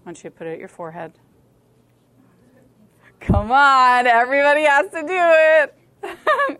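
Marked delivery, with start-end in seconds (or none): 3.2-6.6 high, loud, drawn-out plea; loud coaxing. Real, not acted. Speaker urging girls who won't join in, loud whiny coaxing. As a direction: whine it loudly at the whole room.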